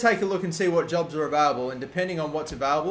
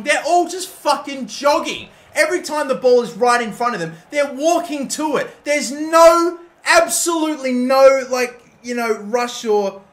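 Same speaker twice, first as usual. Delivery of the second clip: higher, louder exasperated complaint; angry rant; 8 semitones higher, 9 dB louder than usual. Real, not acted. Manager ranting that his players just jog, angry. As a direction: vent at the lazy players.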